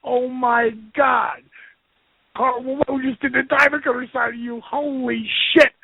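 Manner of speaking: imitating buddy's amazement. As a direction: play the starstruck friend who can't believe it. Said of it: "And Ross goes".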